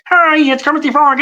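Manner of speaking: very high, bright frog puppet voice; Kermit the Frog. Shown as a demonstration. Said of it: "Kermit's, like, up here".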